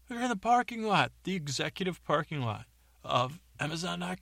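high shouting worker voice; angry fired worker. Acted. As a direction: vent at him, high-pitched. Fired worker Luke explains to James.

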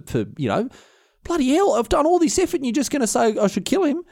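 high, exasperated pleading; stunned disbelief. Retold or imitated. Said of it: "stunned with disbelief".

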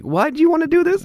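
hammed-up skeptic; skeptical star. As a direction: needle them, unimpressed. Disinterested star questions the pitch.

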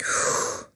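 voiceless rush of breath; imitated calming whoosh. Showing how drink settled her panic, breathy whoosh. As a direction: let the panic go in one breath.

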